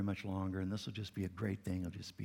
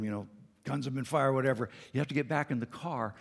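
ordinary delivery

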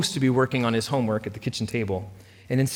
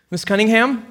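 nervous; 10 semitones higher, 3 dB louder than usual. A narrator's reading. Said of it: "she asked nervously".

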